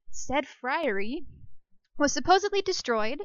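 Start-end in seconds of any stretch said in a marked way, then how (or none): 0.1-1.2 slow, stretched said friary; playfully slowed said friary; drawn-out savoring. Shown as a demonstration. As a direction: linger over friary, dragging it out. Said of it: "one of my new favorite words".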